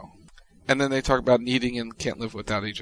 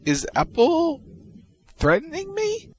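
much higher, slow mock-alarmed question. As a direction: squeak it like you're shocked. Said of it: "C P U K goes".

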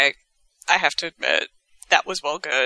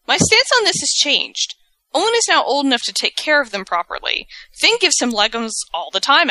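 higher, mock-serious quoting of Kevin; mock Kevin reply; 8 semitones higher than usual. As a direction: read the tweet in a lifted mock voice. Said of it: "To which Kevin replies".

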